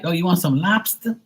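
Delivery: hammed-up Boston accent. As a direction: imitate a Bostonian offering lobster. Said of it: "they got that harsh accent".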